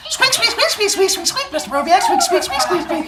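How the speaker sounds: high laughing squeaks; rubbery high squeaky toy. Acted. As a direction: squeak like a tiny mouse. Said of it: "squeaking at me".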